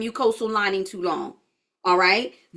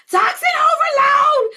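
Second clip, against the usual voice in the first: shouted high alarm, slowed right down; body's alarm call; 13 semitones higher, 7 dB louder than usual. Acted. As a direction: sound the body's panicked emergency siren. Body voiced sending distress call.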